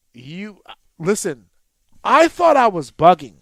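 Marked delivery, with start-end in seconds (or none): 2.0-3.4 excited outburst. Real, not acted. Recalling disbelief at the game.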